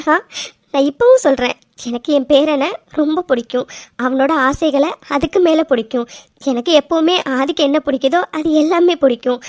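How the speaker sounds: ordinary delivery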